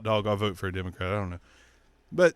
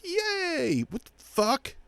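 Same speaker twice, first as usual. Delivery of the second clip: very high cheer turning baffled; mock confused cheer; much slower than usual. Mocking voters, high squeal turning baffled. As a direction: squeal then catch yourself. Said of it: "we're just like".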